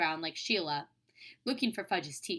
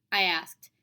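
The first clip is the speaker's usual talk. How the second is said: ordinary delivery, nothing marked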